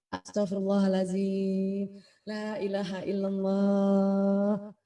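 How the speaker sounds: very slow, drawn-out recitation; slow dhikr demo. Shown as a demonstration. Teacher demonstrating how dhikr pushes air out, slow and drawn out. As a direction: breathe the words out long and even. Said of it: "karbon dioksida keluar duluan".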